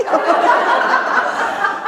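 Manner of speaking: high breathy laugh; unvoiced laughter; amused laugh. Real, not acted. Laughing after voicing students.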